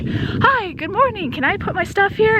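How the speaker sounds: high, sunny morning greeting, pitched well up; bubbly stranger imitated in a high voice; cheerful old guy. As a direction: play the chirpy hiker who just walked in. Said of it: "He's so cheerful, and he's like".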